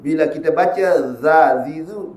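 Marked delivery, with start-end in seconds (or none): 1.1-2.1 thin, open "dha, dhi, dhu"; light like zal. Shown as a demonstration. Teacher shows the misreading that becomes zal, light "dha, dhi, dhu". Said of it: "masuk sifat huruf zal".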